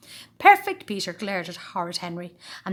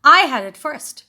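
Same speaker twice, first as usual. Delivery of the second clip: indignant protest; 7 semitones higher, 14 dB louder than usual. A narrator's reading. Peter squabbling over chair.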